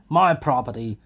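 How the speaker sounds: loud, stubborn cry; defiant shout. A narrator's reading. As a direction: snap it back. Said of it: "shouted Mikolka".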